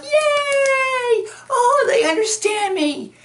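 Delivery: high, squealing fan gush; hammed-up falsetto cheer; mock delight. Fans see their views championed, mock squealing delight.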